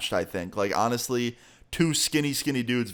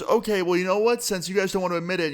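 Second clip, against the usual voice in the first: much higher, fast, escalating taunt; imitating the guy winding up to fight; mock angry taunt; 9 semitones higher, faster than usual. Guy deciding to fight both friends, imitated high taunting. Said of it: "And he goes".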